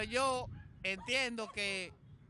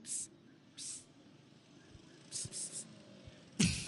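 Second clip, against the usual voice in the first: calling dogs; 7 dB quieter, much slower than usual. Retold or imitated.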